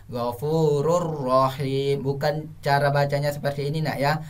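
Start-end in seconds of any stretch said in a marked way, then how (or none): 0.1-2.0 "Ghafurur Rahim" with a held doubled R; idgham with tasydid. Shown as a demonstration. Teacher explaining the rule, "Ghafurur Rahim" with doubled R. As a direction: read "Ghafurur Rahim" drawn out, no N heard. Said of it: "ro itu ditambah tasdid".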